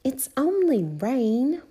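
high, slow, lilting reassurance; Peppa. A narrator's reading. Peppa soothing George about rain, high lilt. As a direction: make light of it for a little brother. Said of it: "said Peppa".

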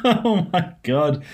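slow, high, loud through laughter; drawn-out laughing disbelief; laughing exclamation. Real, not acted. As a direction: laugh it out in mock dismay. Laughing at co-host's familiar criticism.

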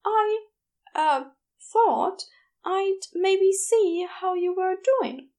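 falter, losing confidence; unsure Aziraphale. A narrator's reading. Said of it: "sounding a little less sure of himself".

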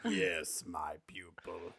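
low, hissing evil whisper; Emperor whisper. Pretend. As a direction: whisper like a sinister old emperor. Emperor Palpatine impression.

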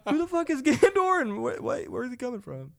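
high, loud question broken by laughter; cracking up while asking; laughing disbelief. Retold or imitated. Friends in the field asking about Gandor, laughing high and loud. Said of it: "we're like".

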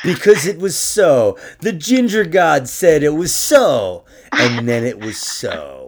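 booming mock-grand declaration, slow; grandiose proclamation. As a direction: proclaim it like a self-made god. Grandiose ginger god proclamation.

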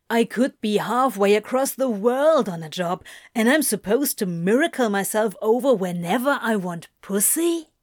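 quick, mildly incredulous protest; somewhat incredulous. A narrator's reading. Demon objecting to travelling for sex, somewhat incredulous. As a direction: rattle off the objection in disbelief, lifting at the end. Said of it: "somewhat incredulous".

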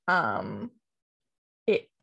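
talking with hiccups. Real, not acted. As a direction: hiccup between words. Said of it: "I don't know why I have the hiccups now".

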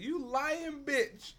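high, very slow, laughing jab; laughing retold jab at the guard; laughing insult. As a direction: drag it out through laughter.

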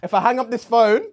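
high, mock panic, laughing through; frantic, begging colleague to take over; nervous breakdown. Operator panicking, calling Sharon.

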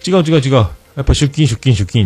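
high, insistent mock boss; imitated hasty retraction. Boss taking back the day off, imitated insistence. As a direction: rattle off an acted correction.

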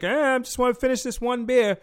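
high, loud mock drunk whine; whiny drunk patron. Patron stalling the bouncer at closing, high whiny drunk voice. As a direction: stretch it out like a tipsy regular.

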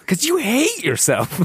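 high slow mocking tease; teasing. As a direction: needle him in a silly voice. Teasing co-host.

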